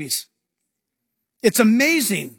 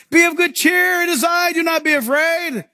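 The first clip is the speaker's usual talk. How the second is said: hearty call of good cheer; 7 semitones higher than usual. Retold or imitated. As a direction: shout reassurance to the boat. Jesus calls across water.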